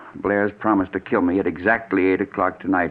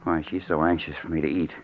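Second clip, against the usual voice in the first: softer, lower muttering to himself; suspicious aside; 5 semitones lower, 8 dB quieter than usual. Acted. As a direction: mutter the suspicion under breath.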